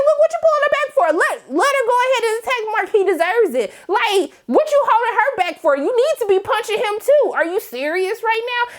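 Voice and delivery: high, fast indignant scolding. Retold or imitated. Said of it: "I said".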